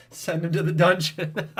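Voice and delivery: playful dungeon sentence; mock villain decree. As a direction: banish him like a cartoon tyrant. Reading chat's joke command.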